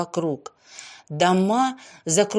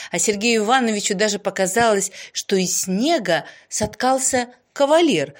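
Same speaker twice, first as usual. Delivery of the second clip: usual speaking voice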